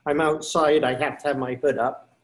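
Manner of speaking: normal talking voice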